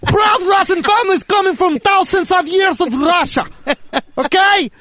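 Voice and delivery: put-on scolding Russian father, lightly accented; Russian dad accent. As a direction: lecture him like a proud Russian dad. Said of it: "continues Russian dad bit".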